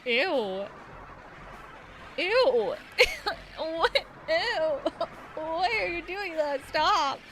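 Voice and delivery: loud, grossed-out protest; disgusted. Acted. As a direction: recoil in disgust. Disgusted reaction to enemy.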